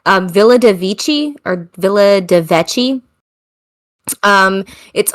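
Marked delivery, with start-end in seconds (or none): none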